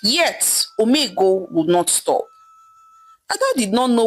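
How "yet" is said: ordinary delivery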